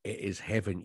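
usual speaking voice

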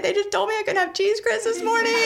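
high, hurried mock blubbering; tearful wail. Patient explaining the cheese grits to her doctor, mock sobbing. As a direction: wail it in a hammed-up crying voice, half laughing. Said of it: "puddles of tears".

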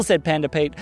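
usual speaking voice

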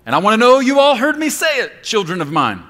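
loud high divine call; God demanding. Acted. God calling his children to respond, loud and high. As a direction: demand an answer like a father God. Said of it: "God says".

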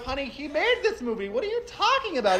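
high, laughing disbelief; incredulous retort. Retold or imitated. Arguing with the fans, high mock disbelief. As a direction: push back like you can't believe it. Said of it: "And I'm like".

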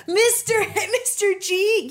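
a show host hyping a surprise guest; excited host reveal. Pretend. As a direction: tease the big reveal.

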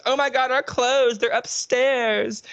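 high, slow dramatic girly mimic; mock Kimberly. Mocking Kimberly missing her clothes, high slow whine. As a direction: wail it like a ditzy scream queen. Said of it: "Kimberly goes".

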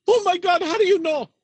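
high-pitched mock shock in Indian accent; astonished scammer. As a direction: exclaim like a caught-out scammer, pitch way up. Acted scammer caught out by hacker.

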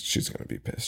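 breathy whispered warning. Real, not acted. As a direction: whisper it low.

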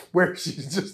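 ordinary talk, nothing marked

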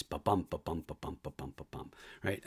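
soft, low da-DUM tapping out the meter; iambic beat. Shown as a demonstration. Actor demonstrating iambic pentameter, softly chanted beats. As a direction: chant "Ba-bum" with the stress on "bum", fading to a near whisper. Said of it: "a breath about every five beats".

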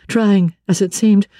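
ordinary delivery, nothing marked

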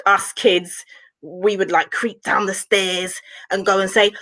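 ordinary delivery

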